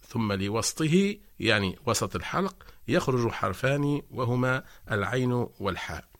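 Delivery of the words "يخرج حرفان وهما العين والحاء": ordinary delivery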